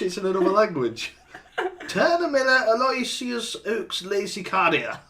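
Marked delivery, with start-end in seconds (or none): 1.9-5.0 put-on slow, higher-pitched posh accent. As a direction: savor each name in a put-on posh accent. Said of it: "the most posh voice you can".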